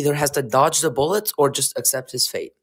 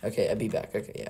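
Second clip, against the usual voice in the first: low half-whispered mutter; muttered aside; 6 semitones lower, 10 dB quieter, faster than usual. Real, not acted. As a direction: say it under your breath.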